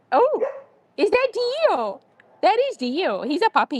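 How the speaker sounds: high, delighted cooing to a dog; excited pet talk. Real, not acted. Visitor introduces a puppy to a dog, excited high coo. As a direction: start way up high and gush.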